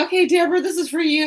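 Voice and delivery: high, loud sing-song pitch; card reader pressing a stranger, high and loud; pushy reader. Retold or imitated. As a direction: sell the reading brightly. Reader announcing a reading.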